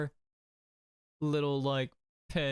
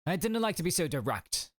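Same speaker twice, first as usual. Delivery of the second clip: higher, lightly attempted Scottish accent; 6 semitones higher, faster than usual. Prison governor being blunt with visitors, attempted Scottish accent. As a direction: try a Scottish lilt, pitched up. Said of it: "He's Scottish?".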